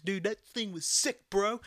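mock bro voice. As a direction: imitate a try-hard dude. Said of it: "I hate those motherfuckers".

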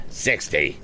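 ordinary delivery

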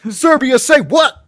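high, slow, loud hype man holler. Acted. Hype man cutting into sex talk, hype man holler. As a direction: shout it to hype up the room. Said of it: "hype man for like, a, a sex talk".

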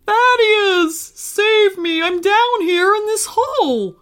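high, loud cry for help; calling out. Acted. Trapped Pizza calls Thaddeus for rescue, high distressed shout. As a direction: call up from far below in a put-on voice. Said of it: "he heard someone calling him by his name".

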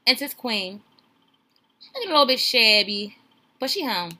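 a loud, swaggering hint of a pimp showing off; pimpin' voice. Streamer hyping the show's queen in a loud pimp voice. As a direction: lean into it like a pimp bragging. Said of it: "Let me put some pimpin' in it".

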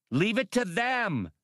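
high, changed female voice; Hasty's reply. A narrator's reading. Hasty answers Han, pointing at the swimmers, high voice. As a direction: answer high over the noise.

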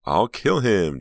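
loud, high cry with a tight edge; tight, trembling cry. A narrator's reading. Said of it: "cried in a tight, trembling voice".

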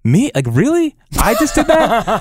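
incredulous, climbing high at the end; mock disbelief. Pitcher retelling his reaction to allowing a home run, incredulous. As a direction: question yourself in rising disbelief.

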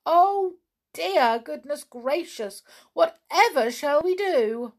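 high, agitated pixie dismay; flustered little creature in alarm; fretting pixies. A narrator's reading. Frightened pixies fretting about witches.

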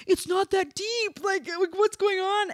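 put-on high, slow, pleading kids' voice; kids screaming. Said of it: "the kids are screaming".

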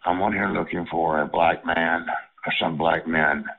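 usual speaking voice

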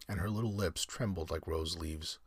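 plain reading voice

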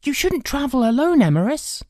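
put-on high, loud boyish warning; concerned boy. A narrator's reading. Boy warning the old traveller, concerned. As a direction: caution him in a high voice. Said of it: "Arthur said".

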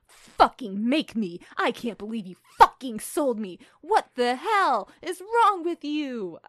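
slow, loud, furious defiance; screamed outrage. Acted. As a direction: yell each word at your mother. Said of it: "I screamed at her".

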